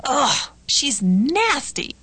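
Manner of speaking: slow, drawn-out disgust; acted disgust. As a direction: recoil in disgust, stretching the words. Dialogue: reacting with disgust to someone.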